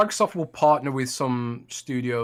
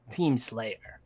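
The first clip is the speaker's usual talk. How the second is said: Halo announcer voice; 5 dB quieter than usual. Shown as a demonstration. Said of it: "it's kinda nasally, that voice".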